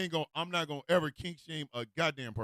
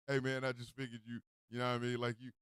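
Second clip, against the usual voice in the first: low, soft casual excuse; sheepish excuse; 6 semitones lower, 9 dB quieter, faster than usual. Acted. Roommate Todd explaining himself to Mike, sheepish excuse. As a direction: play it down under your breath.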